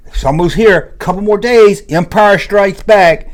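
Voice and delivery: put-on excited child voice; excited kid. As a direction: gush like a kid counting down the days. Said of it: "I was so excited".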